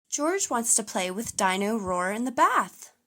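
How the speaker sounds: plain reading voice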